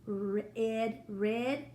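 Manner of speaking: "red" said as "rid", sounded out then blended; "red" with a short i, as in "rid". Shown as a demonstration. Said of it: "instead of making it like".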